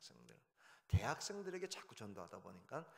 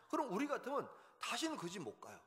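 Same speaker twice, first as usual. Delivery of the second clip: ordinary talk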